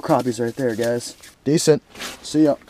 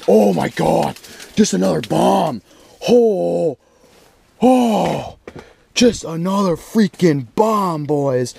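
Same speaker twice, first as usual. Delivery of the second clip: higher, thrilled exclaiming; real delight at the catch; pitched-up happy disbelief; excited; 5 semitones higher, much slower than usual. Real, not acted. Another big crappie landed.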